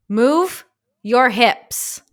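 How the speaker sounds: slow, loud barked command; drill sergeant. Shown as a demonstration. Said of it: "I sound like a drill sergeant".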